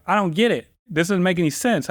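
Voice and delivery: a touch of whiny indignation; mock indignant protest. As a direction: protest like a stubborn kid. Retold protest, raised pitch.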